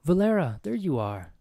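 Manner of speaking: man doing a woman's voice; high, loud put-on woman voice. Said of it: "do you like my woman voice?".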